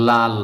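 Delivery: লাল given as the model answer; clear, firm লাল for students to copy; correct pronunciation. Shown as a demonstration. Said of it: "সঠিক উচ্চারণ হবে". (সঠিক উচ্চারণে লাল)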